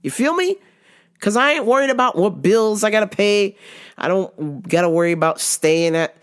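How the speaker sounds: normal talking voice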